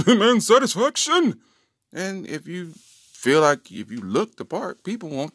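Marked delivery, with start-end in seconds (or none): 0.1-1.4 loud, high mock-aristocrat outburst; pompous demand. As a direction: demand it like a hammed-up duelling gentleman. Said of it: "if you got that kind of confidence".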